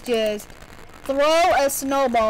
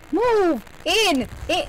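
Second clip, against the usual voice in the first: shouting; 5 semitones higher, slower than usual. Real, not acted. Shouting at character.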